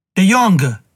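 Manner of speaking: chiamata alta e forte; grido affannato. Acted. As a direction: grida il nome con il fiato corto. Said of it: "gridò Herkimer col fiato grosso". (generale ferito grida al capitano, col fiato grosso)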